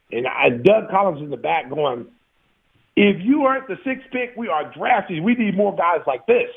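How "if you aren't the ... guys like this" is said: raised pitch, emphatic endorsement; coach calling out. Retold or imitated. Head coach backing the feisty player from the back of the room, emphatic. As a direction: imitate a coach chiming in from the back.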